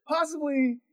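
trying to make sense of it; retold bewilderment; disbelief. Driver drove off with Gatorades.

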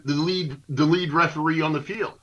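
ordinary talk

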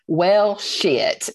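imitating a dad's unimpressed deadpan; deadpan sarcasm. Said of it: "his response was just deadpan".

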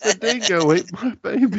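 high-pitched mock wail; movie quote. Riffing on a botched accent, high mock cry. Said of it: "that's my favorite line from, uh, Tropic Thunder".

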